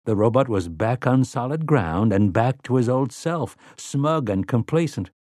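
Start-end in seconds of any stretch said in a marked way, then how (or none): none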